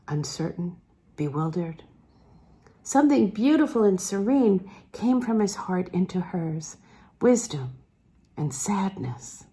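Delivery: ordinary delivery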